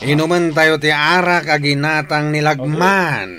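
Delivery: loud, merry chief calling for drink; loud, boisterous feast toast; rowdy toast. Acted. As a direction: rouse the gang to drink.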